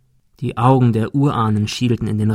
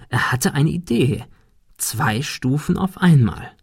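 ordinary delivery

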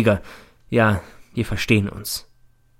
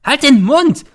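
very high, loud hysterical screech; 10 dB louder, slower than usual. A narrator's reading. Mother cuts off her son, screeching. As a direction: shriek it to silence him. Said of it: "kreischt die Mutter".

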